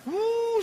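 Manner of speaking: long, high "uuh" of flight; drawn-out high whooshing hoot; imitated arrow whoosh. Shown as a demonstration. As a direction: send the arrow off vocally.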